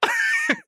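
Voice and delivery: squeaky high laugh. Real, not acted. Storyteller laughing hard at his memory, squeaky laugh.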